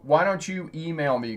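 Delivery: ordinary talk, nothing marked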